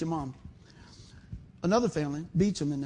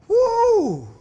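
high loud joyful woo; joyful whoop; 13 dB louder than usual. Real, not acted. Preacher whooping to the church over the answered prayer. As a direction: shout it for joy. Rejoicing at her salvation.

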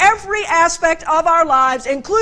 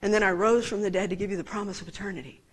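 tender voice of God; 6 semitones lower, 7 dB quieter, faster than usual. Retold or imitated. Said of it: "God said".

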